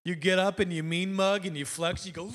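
normal talking voice

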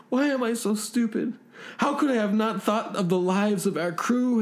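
high, whimpering character voice; shivering, terrified crewman. Acted. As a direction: wail in self-reproach, pitched high. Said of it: "shivering and screaming".

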